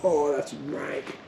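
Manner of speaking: quiet, slow, grossed out; soft slow disgusted groan; disgusted after a fly. Real, not acted.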